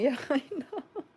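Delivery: high laughing reply. Real, not acted. To viewers all trying the trick, a high laughing yeah. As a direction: laugh the words out.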